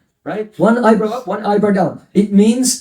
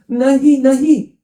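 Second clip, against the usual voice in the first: a touch of a trembling, higher "Nahi, nahi"; trembling refusal; 5 semitones higher than usual. Acted. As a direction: say "Nahi, nahi" higher, with a faint quiver of ecstasy. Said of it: "her voice is trembling".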